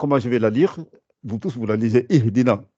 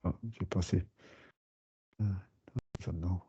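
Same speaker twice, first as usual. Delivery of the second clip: quiet aside; 2 semitones lower, 14 dB quieter than usual. Real, not acted. Searching the page, aside.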